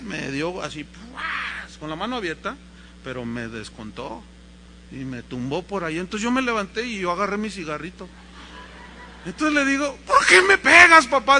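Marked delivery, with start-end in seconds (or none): 0.8-1.8 high, softer smack noise; mimed whack sound; imitated slap. 10.1-11.4 loud, high outcry; acted hurt little boy; protesting child. Boy protesting being hit.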